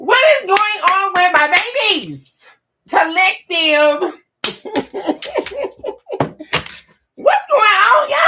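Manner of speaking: excited greeting. Real, not acted. Greeting viewers loudly.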